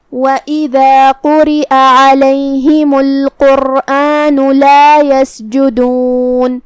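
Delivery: slow chanted tajweed recitation; melodic, measured verse chant; Quran recitation. Acted. Teacher reciting verses for students, slow chant.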